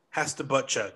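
ordinary talk, nothing marked

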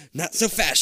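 light warning with a bit of force. A narrator's reading. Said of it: "she warns".

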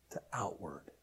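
soft whispered "outward", falling. Acted. Preacher contrasting inward and outward, whispered. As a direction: drop to a hushed whisper.

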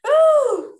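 high, loud delighted ooh; real excited squeal. Real, not acted. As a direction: squeal it with glee. Delighted exclamation after laughing.

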